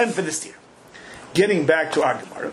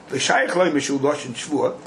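ordinary talk, nothing marked

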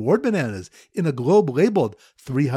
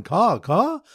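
higher, louder caw caw cry; mock bird call; 5 semitones higher, 3 dB louder than usual. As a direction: call like a crow, a little hammed-up. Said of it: "Bird caller is going".

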